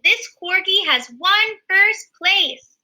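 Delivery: mild announcer voice for the crowd; announcer proclaiming. Acted. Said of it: "The announcer proclaimed".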